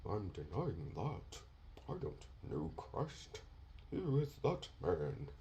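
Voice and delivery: soft, timid denial voice; meek Peter. Retold or imitated. Preacher mocking a timid Peter denying Christ, soft and meek. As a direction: hush it like a scared man. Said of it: "He wasn't standing there".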